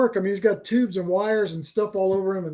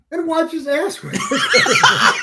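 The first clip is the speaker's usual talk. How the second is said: high, laughing through the words, slow; cracking up at the punchline; 13 semitones higher, 3 dB louder, much slower than usual. Real, not acted. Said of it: "punchline laughing".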